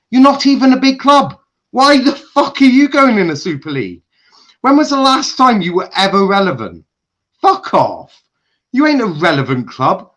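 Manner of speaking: high, loud, scornful yelling; raging shout. Real, not acted. Fan berating Tottenham in a furious shout. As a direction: tear into them at full volume. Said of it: "The rage I have building up".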